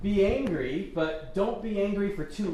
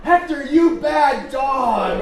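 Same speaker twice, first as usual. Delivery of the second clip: loud, high, slow scolding of a dog; imitated shout, every word drawn out; retold yelling; 12 semitones higher, 11 dB louder, slower than usual. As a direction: holler it so the neighbors hear. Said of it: "I quickly yelled again".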